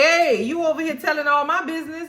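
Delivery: high-pitched, indignant imitation of an accuser; hammed-up accusatory challenge; mock confrontation. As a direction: needle them with pointed questions up high. Acting out Dallas confronting Jim.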